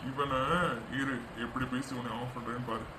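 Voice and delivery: hushed low plotting aside; conspiratorial murmur; sly aside. Acted. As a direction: lean in and scheme under your breath.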